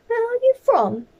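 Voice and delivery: high squeaky little bear voice. Acted. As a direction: lift up into a small bear's voice.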